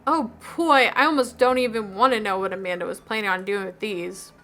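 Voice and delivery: ordinary delivery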